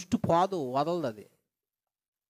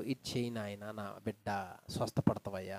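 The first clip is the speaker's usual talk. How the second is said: soft, lower, tender fatherly voice; loving; 11 semitones lower, 11 dB quieter than usual. Retold or imitated. God lovingly telling his child to obey, tender. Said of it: "అని ప్రేమతో చెప్పాడు".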